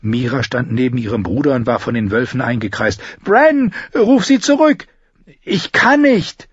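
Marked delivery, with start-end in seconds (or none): 3.2-4.9 high, loud command; urgent shout. Acted. Mira yells at Bran to call the wolf back, high and loud. 5.4-6.5 high, loud, slow despair; panicked cry. Acted. Bran cries out in panic to Mira, high and loud.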